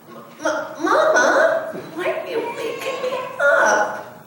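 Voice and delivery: a sleepy cub just woken; sleepy little cub. Acted. Little cub asking Mama why she's woken.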